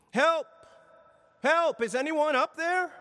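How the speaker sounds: high, loud desperate cries for help; yelling for help. A narrator's reading. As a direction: call up the cliff as if hanging on for life. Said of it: "Jack began yelling for help".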